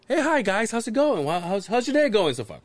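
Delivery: high-pitched mock server welcome; imitating a waitress, pitch lifted then easing down; perky waitress greeting. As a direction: put on a chirpy waitress hello. Acted waitress greeting the table.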